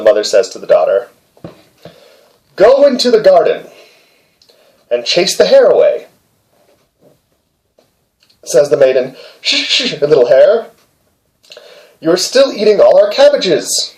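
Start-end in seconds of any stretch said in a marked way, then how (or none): 2.6-6.1 high, raised commanding mother; a hint of a bossy mother; mother's command. Mother orders daughter in story. 9.4-13.9 put-on high, raised girlish scolding; lightly voiced scolding girl; girl's voice. Girl scolds hare third time.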